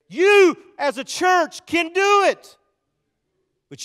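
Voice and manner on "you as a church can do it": high, loud, drawn-out preacher shout; rallying shout. Real, not acted. Preacher answering a doubter before the church, rallying shout. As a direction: shout it slowly to lift the congregation.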